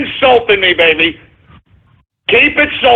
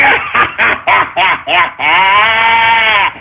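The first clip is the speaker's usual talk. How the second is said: shouted cackle; 5 semitones lower than usual. Acted. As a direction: laugh in their faces. Gloating at trolls.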